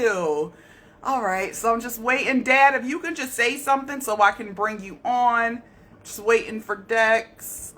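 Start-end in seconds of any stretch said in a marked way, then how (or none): none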